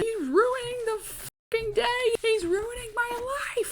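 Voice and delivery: high, slow whining fake-cry; whiny crying brat. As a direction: wail like a spoiled kid cheated of his day. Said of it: "starts crying and bitching".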